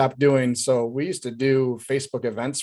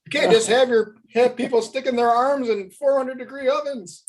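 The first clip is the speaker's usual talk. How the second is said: Kramer impression; 12 semitones higher than usual. Kramer Seinfeld reference voiced.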